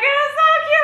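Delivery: very high-pitched fangirl squeal; high, adoring gush. Real, not acted. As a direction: squeal it as if overcome by cuteness. Gushes over idol being cute.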